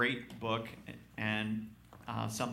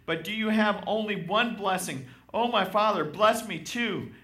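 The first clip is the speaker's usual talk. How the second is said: pleading; 10 semitones higher, 8 dB louder than usual. Acted. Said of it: "Esau pleaded".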